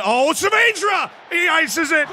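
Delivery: high, loud goal yell; celebrating a goal; excited shout. Real, not acted. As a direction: scream the scorer's name.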